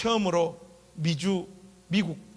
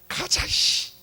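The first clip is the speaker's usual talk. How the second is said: put-on gruff old guard; much slower than usual. Guard insisting on buying dinner.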